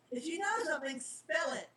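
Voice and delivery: a lightly voiced demanding kid. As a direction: push him to spill it. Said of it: "Charlie demanded".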